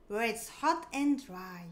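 usual speaking voice

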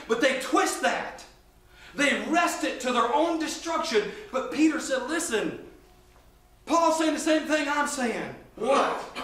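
full-force preaching. Real, not acted. Preacher delineating which body Paul means, full-force preaching. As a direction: build the argument with rising, punched key words.

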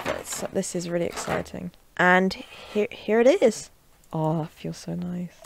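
ordinary delivery

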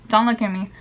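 ordinary talk, nothing marked

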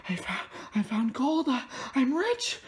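play-acted stunned joy; mock elated discovery. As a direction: let pretend excitement climb in pitch. Pretends to have struck gold.

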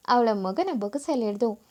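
ordinary delivery

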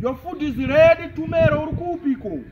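yelling across the room. Acted. Calling child to food.

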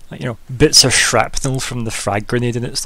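usual speaking voice

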